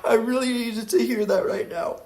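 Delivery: high, relieved new parent voice; a high, thankful sigh of relief; grateful new parent. Pretend. Tired parent thanking the podcast, high relieved voice. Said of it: "And they're like".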